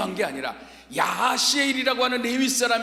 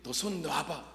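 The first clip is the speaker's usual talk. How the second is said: lower, softer voice of God; 6 semitones lower, 9 dB quieter, slower than usual. Retold or imitated. God telling the man to let go, low and soft. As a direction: give the command quietly from above.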